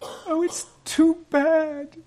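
slow, very high tearful wail; drawn-out, high girlish sob; sobbing. A narrator's reading. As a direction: sob it out like a heartbroken girl. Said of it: "sobbed Lucy".

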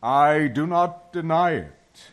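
slow, loud, weighty; Aslan's solemn admission. A narrator's reading. Aslan admits the witch's claim is true, solemn. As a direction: concede it slowly with full authority.

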